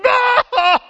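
a high, loud, hammed-up "No!"; mock ecstatic cry. A worshipper outdoing her neighbour, with a high, hammed-up cry. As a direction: outdo the person next to you with a cry.

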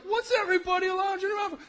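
high, loud incredulous shout; mock shout. Jacob shouting at the idle shepherds, mock outrage. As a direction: shout at the idlers in disbelief. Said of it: "he's like".

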